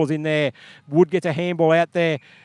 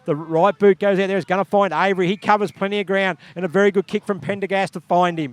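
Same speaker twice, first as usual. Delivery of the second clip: brisk, slightly raised match call; live play-by-play; 3 semitones higher than usual. Acted. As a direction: keep the ball moving in your voice. Play-by-play continues after remark.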